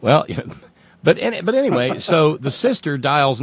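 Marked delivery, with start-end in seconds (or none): none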